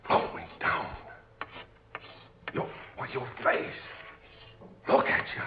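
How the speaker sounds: hushed, breathless, scared whisper; frightened whisper. Acted. Sailor facing the intruder in the cabin, fearful whisper. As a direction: whisper it as if you can't believe your eyes.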